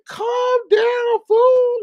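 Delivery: high shouted calm down, fool; yelled retort. Retold or imitated. Streamer shouting back at the angry man, high loud yell. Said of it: "I'm like".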